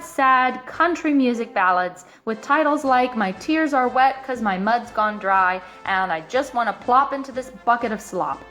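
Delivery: ordinary delivery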